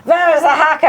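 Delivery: mock Russian officer's accented demand; Russian accent retry. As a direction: have another go at a Russian accent. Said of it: "more of a German accent, but they're like".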